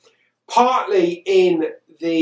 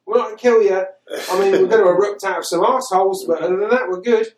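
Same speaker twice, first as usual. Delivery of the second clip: high, mock-reassuring alien voice; hammed-up friendly pleading; nice aliens; 4 semitones higher, 4 dB louder, faster than usual. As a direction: sell it brightly like a harmless invader. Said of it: "implanting suggestions in his head that they're nice".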